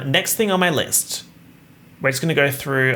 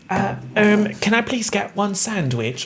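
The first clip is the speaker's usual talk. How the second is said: mock customer; 8 semitones higher than usual. Mocking someone asking for sandwich.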